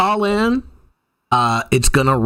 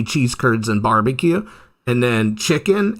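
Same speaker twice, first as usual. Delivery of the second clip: ordinary delivery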